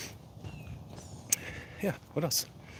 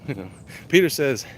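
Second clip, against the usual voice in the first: ordinary talk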